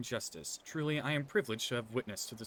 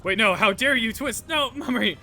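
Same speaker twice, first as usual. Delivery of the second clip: very high, loud cry; outraged shout; 8 semitones higher, 10 dB louder than usual. Acted. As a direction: yell in shocked outrage.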